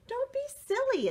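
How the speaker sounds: high dismissive voice; high scoffing crayon voice. Acted. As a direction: brush it off up high. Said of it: "Hazelnut said".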